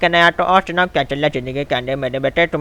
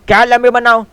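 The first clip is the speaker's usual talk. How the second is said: louder Pathan retort; 7 semitones higher, 6 dB louder than usual. Acted. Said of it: "Manoj के बच्चे बातें कर रहा".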